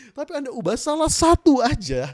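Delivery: ordinary talk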